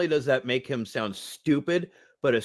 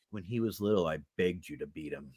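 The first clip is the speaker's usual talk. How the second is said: deadpan grandma; 11 semitones lower, 11 dB quieter than usual. Retold or imitated.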